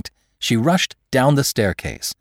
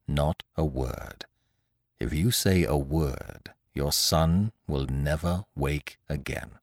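low, soft and slow, ominous; menacing note; 6 semitones lower, 7 dB quieter, slower than usual. A narrator's reading. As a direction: read it like a quiet threat. Threatening note read aloud.

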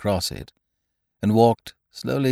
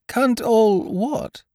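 much higher, slow puzzled bear; puzzled Pooh; 13 semitones higher, much slower than usual. A narrator's reading. Pooh asks Eeyore what he means, high and puzzled. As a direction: ask slowly, baffled. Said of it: "said Pooh".

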